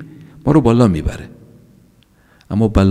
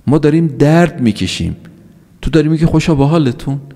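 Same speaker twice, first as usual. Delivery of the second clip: higher-pitched, mildly affronted challenge; retold indignant protest; 6 semitones higher than usual. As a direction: question him in disbelief, as a retold complaint. Sufferer challenges the sheikh, retold.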